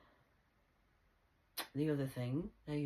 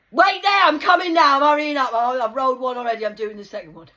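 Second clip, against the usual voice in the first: eager hurry; 10 semitones higher, 22 dB louder than usual. Retold or imitated.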